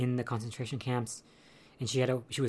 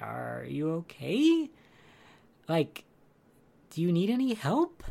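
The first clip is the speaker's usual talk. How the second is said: slow, drawn-out question rising high at the end; tentative checking in; 5 semitones higher than usual. Retold or imitated. Girl checking on the boy in the stall, tentative. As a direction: imitate a hesitant, concerned girl calling in. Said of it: "goes down there and is like".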